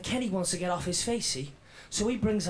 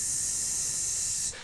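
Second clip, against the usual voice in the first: hissing gas. Retold or imitated. Gas pouring out of pipe.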